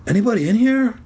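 high small calling voice; strange tiny voice. A narrator's reading. Zeke at the dark doorway, tiny strange call. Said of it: "Zeke called in a strange, tiny voice".

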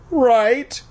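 high nervous squeak. Acted. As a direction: squeak it out, hoping. Nervous plea to bully.

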